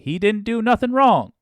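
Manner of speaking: high, loud put-on rural accent, far from own voice; mock rural accent. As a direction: ham up a rural accent, then catch yourself. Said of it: "uh, that accent".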